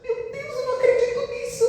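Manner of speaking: half-laughing, panicked protest to the doctor; mock despairing outcry; desperate disbelief. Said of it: "eu fiquei tão desesperada".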